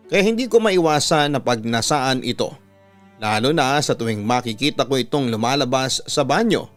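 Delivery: ordinary talk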